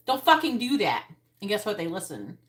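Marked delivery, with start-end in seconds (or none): 0.1-1.1 loud, firm command; firm yell. Retold or imitated. Parent yelling at kids to stop, loud firm command. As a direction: bark it at the kids. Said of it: "You say".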